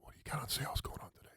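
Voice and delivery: very low, breath-only murmur; seductive whisper. Shown as a demonstration. Co-host demonstrating a pickup whisper back by the cubicle, hushed whisper. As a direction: whisper it close, deep and hushed. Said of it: "if you whisper".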